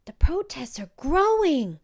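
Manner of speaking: put-on eager child announcing news; high excited girl voice; little girl's voice. A narrator's reading.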